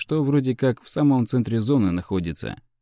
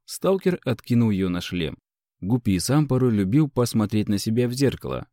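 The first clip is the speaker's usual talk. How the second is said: plain reading voice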